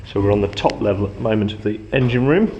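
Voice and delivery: ordinary delivery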